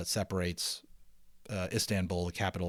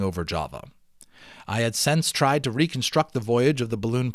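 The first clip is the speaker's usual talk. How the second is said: steady read-aloud storytelling voice; story narration; 8 semitones higher, 10 dB louder than usual. Acted. Teacher reading the balloon novel aloud to students, storytelling narration. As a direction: read it like a chapter book to the class.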